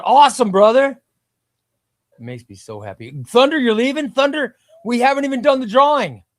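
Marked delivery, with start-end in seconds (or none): 0.0-1.0 loud, high, delighted shout-out; excited cheer. Real, not acted. Thanking a new Patreon supporter. 3.2-6.3 high, loud protest; loud disbelief. Real, not acted. Protesting a viewer leaving early.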